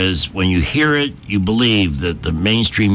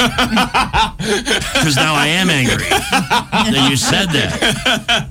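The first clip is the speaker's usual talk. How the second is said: laughing; 13 semitones higher, much slower than usual. Real, not acted. Laughing mock anger.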